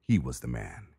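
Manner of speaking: ordinary delivery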